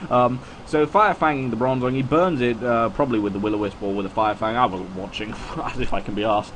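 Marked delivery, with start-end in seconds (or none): none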